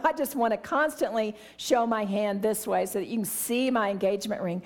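ordinary delivery

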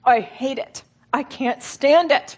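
slow and strained, rising in pain; anguished prayer. Retold or imitated. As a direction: pray it through gritted teeth, hurting. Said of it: "gritted teeth and many tears".

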